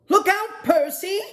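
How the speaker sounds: small penguin warning. Acted. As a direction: squeak out the warning like the littlest penguin. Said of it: "the smallest penguin said".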